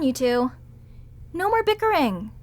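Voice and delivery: ordinary talk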